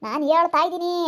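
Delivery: ordinary talk, nothing marked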